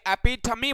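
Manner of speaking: एपिथमी in four syllables, ending in -mee; correct pronunciation. Shown as a demonstration. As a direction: say एपिथमी with a final ee, e-PI-tha-mee. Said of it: "एपिटोम या एपिथूम नहीं बोला जाता".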